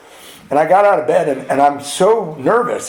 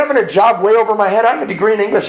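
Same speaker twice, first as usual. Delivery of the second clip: usual speaking voice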